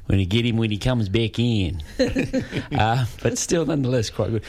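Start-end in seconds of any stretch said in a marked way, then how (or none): 1.9-2.7 high laugh. Real, not acted. Amused by Katie's impression.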